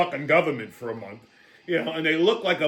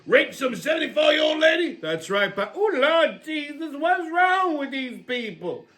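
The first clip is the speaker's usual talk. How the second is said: Big Mama character; 6 semitones higher than usual. Acted. Big Mama teasing Leroy about the news, high brassy voice. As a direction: cackle and tease like a brassy old auntie.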